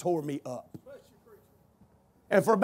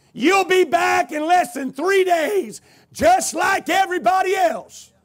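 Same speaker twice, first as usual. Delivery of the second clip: loud, high, slow sneer; scornful prediction; 10 semitones higher, 6 dB louder, slower than usual. Retold or imitated. Doctor sneering at the patient on his way out. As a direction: spit the prediction at him.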